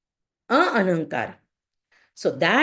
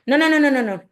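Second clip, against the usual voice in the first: higher, louder flurry of no; retold firm refusal; 7 semitones higher, 5 dB louder, much faster than usual. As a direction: shut them down at the door. Woman refuses at the door.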